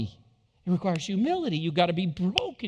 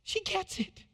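delighted hush; 12 semitones higher, 7 dB quieter than usual. Pretend. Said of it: "he's thinking to himself".

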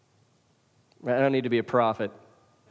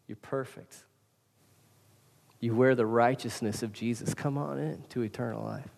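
gentle voice of God; 4 semitones lower, 6 dB quieter than usual. Retold or imitated. God's welcome voiced softly.

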